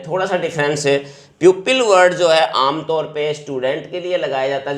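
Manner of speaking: ordinary talk, nothing marked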